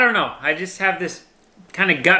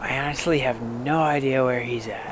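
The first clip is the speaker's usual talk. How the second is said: softly spoken, hushed; quiet; 5 semitones lower, 6 dB quieter than usual. Real, not acted. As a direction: keep it down so deer stay. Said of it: "I'm trying to talk quiet right now".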